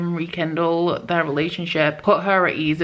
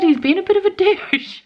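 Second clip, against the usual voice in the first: breaks into a high laugh mid-line; amused, giggling jab; 14 semitones higher, 4 dB louder, faster than usual. Real, not acted. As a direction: laugh out the insult. Calling the main character a douche.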